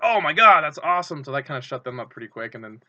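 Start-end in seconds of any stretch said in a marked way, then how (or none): 0.0-1.2 sudden high, loud burst of joy; excited cheer. Retold or imitated. Fan in the arena cheering the equalizer, excited. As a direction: jump up as the home team ties it. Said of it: "And I was like".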